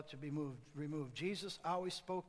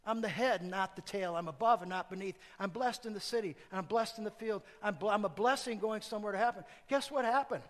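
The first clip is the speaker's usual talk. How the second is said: louder confident proclamation; proclaiming declaration; 3 semitones higher, 6 dB louder than usual. Shown as a demonstration. Preacher modelling a bold confession for the congregation. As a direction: declare each blessing like a victory.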